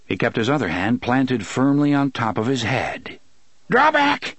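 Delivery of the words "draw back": high, urgent cry; high desperate command; panting warning. A narrator's reading. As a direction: call out the warning, high and strained. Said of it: "he panted".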